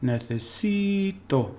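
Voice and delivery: slow necesito, si lifted high; stress on si. Shown as a demonstration. Teacher slowly modelling the stressed syllable for learners. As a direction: stretch out necesito, leaning on si. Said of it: "stress is on the second to last syllable".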